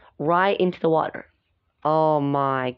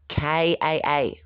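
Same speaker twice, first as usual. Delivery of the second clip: plain reading voice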